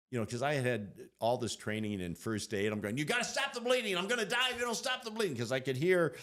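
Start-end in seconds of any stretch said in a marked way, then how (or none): 3.0-5.4 high, fast, urgent orders; urgent plea. Retold or imitated. Trapped under a car, begging helpers to stop bleeding, high and urgent. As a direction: bark out first-aid orders in a hurry.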